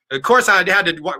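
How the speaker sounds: normal talking voice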